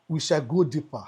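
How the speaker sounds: ordinary delivery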